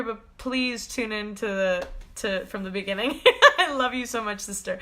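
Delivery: ordinary talk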